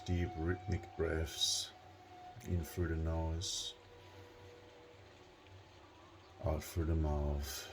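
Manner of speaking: hushed, spacious meditation delivery; guided meditation. Real, not acted. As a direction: guide them gently into relaxation, unhurried.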